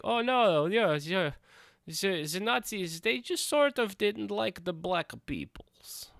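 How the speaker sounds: put-on high, breathy-sounding caricature Russian accent, hushed at the end; a hammed-up Russian accent that slips in by mistake; mock Russian accent. Vlogger mocking the game's Nazi characters in a Russian accent. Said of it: "I don't know why he went Russian".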